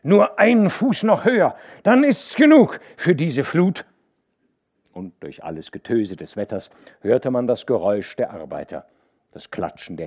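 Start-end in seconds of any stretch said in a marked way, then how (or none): 0.0-3.8 loud, high urging call. A narrator's reading. The dike reeve urging the workers to hold on, shouting. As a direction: shout the last push over the wind.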